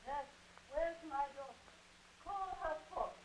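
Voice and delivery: Lady Capulet heard from the wings; far-off voice projecting from the stage; distant stage voice. Acted. Play heard from backstage.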